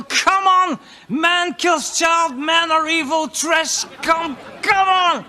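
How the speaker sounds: high, loud, slow shouting search engine; mock Google voice. Comedian voicing Google pushing him toward men as killers, shouting. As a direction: yell like a pushy search engine. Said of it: "And Google".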